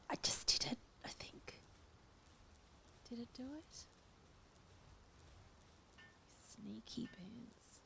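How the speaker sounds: whispering in delight over a sneaky build. Real, not acted. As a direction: gasp it out under your breath. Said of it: "I don't know why I'm whispering".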